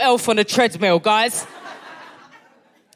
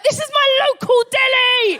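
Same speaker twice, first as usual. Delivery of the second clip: outraged realisation. Retold or imitated. Woman finding she was a street from home, outraged realisation. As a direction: wail each word in hammed-up exasperation.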